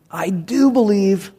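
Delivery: slow, measured declaration of belief; mildly exclaimed, drawn out for emphasis. Acted. Father answering Jesus in the read verse, mildly exclaiming. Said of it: "the boy's father exclaimed".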